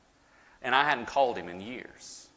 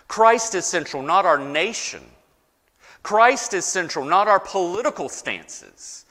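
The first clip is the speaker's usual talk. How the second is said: building preaching climax, higher and louder on each Christ; preaching at full force; 6 semitones higher, 6 dB louder than usual. Acted. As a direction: drive the refrain home from the pulpit. Sermon climax, repeated refrain.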